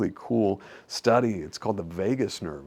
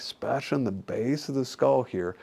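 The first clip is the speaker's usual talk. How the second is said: ordinary talk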